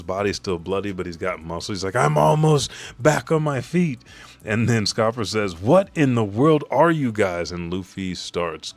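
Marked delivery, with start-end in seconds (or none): 2.0-4.0 high, loud put-on voice; acted hero voice. Luffy bulked up after eating, high loud declaration. 5.6-7.5 loud, higher baffled outcry; acted astonishment. Ally baffled by the recovering fighters, loud astonished outcry.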